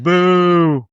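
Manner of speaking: loud mock boo; jeering boo from the crowd. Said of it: "was like".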